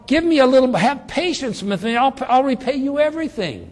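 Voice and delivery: high, loud pleading for mercy; desperate begging. Retold or imitated. Slave begs his master to be patient, high loud pleading. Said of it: "So he says".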